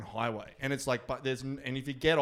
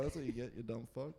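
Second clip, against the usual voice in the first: muttered jab; 4 semitones lower, 14 dB quieter than usual. Retold or imitated. Muttered insult at friend.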